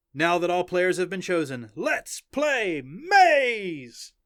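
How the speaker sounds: high, loud, hugely drawn-out kickoff; excited announcer. A narrator's reading. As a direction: launch the game, stretching the last words. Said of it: "The announcer said".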